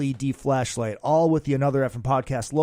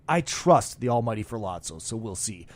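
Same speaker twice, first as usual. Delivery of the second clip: ordinary delivery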